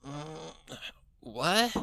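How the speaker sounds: groggy waking mumble then a sharper what; just woken. Acted. Rainbow Dash woken from her nap by knocking, groggy. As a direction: stir from sleep and grunt it out. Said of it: "as she stirred from her sleep".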